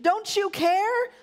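high, drawn-out pleading question; slow wail rising high, acted for a story; shrieked reproach. A narrator's reading. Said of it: "they shrieked".

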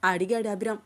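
plain reading voice

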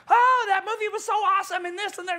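high-pitched parody of an excited moviegoer; irritatingly high mock enthusiasm; mock gushing. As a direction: gush it high, making fun of the speaker. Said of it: "It was so irritating me".

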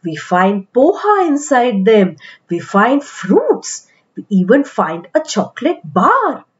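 ordinary delivery, nothing marked

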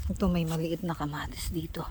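soft whispered aside; whispering. Real, not acted. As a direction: whisper it, unvoiced.

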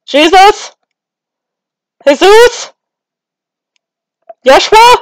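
very high, loud rising calls; calling out. Acted. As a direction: call out for someone who isn't answering, each word rising. Calling Jesus back, no answer.

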